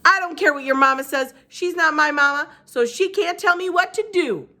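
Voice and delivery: loud, rising angry shout; childish outburst at a bully. A narrator's reading. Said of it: "I shouted at Stevie".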